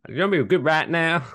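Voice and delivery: playful put-on Southern accent. Host leading into the next segment, Southern accent.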